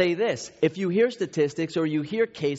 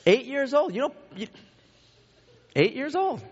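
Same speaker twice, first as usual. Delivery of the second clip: high-pitched, incredulous indignation; pitched way up, mock outrage; retold exasperation; 10 semitones higher than usual. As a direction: replay an exasperated dad's disbelief. Got angry at both of them.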